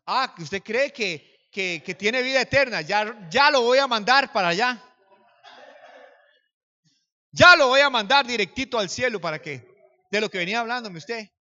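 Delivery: retold threat, hammered out loudly; robber's mocking threat. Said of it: "el hombre me decía".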